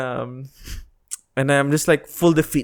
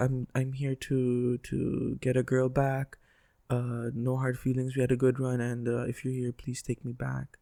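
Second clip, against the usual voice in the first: flat sincere delivery; 3 semitones lower, 11 dB quieter than usual. Shown as a demonstration. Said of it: "But I delivered it like this".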